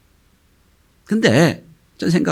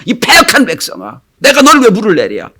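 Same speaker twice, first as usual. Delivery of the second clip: acted outburst, raised and louder; angry rebuke; 7 semitones higher, 4 dB louder than usual. Moses berates the people while striking the rock, angry. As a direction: scold the crowd in acted anger. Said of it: "너무 화가 난 나머지".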